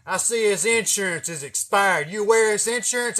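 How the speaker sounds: cocky deputy grilling a driver; prick cop voice. Retold or imitated. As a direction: play the smug cop leaning on him. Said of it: "talking like a fucking prick motherfucker".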